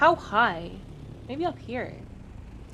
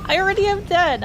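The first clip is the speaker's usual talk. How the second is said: exasperated; 9 semitones higher, 7 dB louder than usual. Real, not acted. Exasperated about vehicle count.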